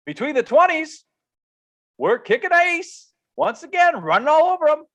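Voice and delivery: high, loud, excited crowing; hyped-up enthusiasm. Real, not acted. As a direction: pitch soaring, hype up the team. Hyped recap of team's play.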